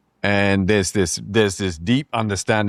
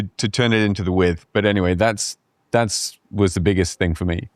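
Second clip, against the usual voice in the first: usual speaking voice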